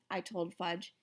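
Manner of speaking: plain reading voice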